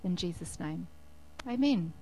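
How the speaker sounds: ordinary delivery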